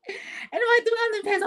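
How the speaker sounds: very high, fast mock wail; exasperated scolding. Aunt scolding her about pants, high, fast and exasperated. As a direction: imitate a frantic aunt in a rushed high squeal.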